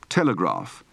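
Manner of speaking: "telegraph" ending in GRAHF; long ah in the last syllable of "telegraph"; broad open-throated A. Shown as a demonstration. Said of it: "Practice for the broad, open-throated A".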